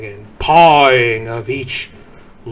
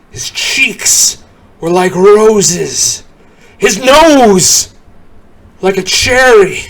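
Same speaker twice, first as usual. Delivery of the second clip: higher, louder and slow; delighted; 7 semitones higher, 9 dB louder than usual. A narrator's reading. Narrator delighting in Saint Nick's rosy face, excited. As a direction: savour each cheerful image.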